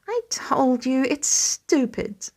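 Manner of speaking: a slow, sulky grumble; the fight gone out of him, muttering; dejected grumble. A narrator's reading. Teen grumbling to dad about his sling, slow and dejected. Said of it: "he grumbles dejectedly".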